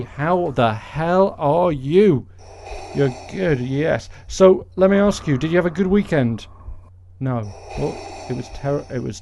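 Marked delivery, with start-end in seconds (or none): none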